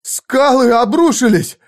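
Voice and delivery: high, drawn-out cry of shock; bewildered exclamation. A narrator's reading. Squire sees the cliffs fall, exclaiming in bewilderment. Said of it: "воскликнул растерянно оруженосец".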